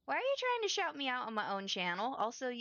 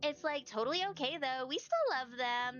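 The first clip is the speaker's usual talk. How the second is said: put-on bubbly Valley Girl voice; gushing Valley Girl read; Valley Girl accent; 2 semitones higher than usual. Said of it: "Do you like my Valley Girl accent?".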